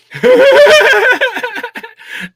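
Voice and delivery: shrieking laugh; high cackling laugh. Real, not acted. Laughing at self.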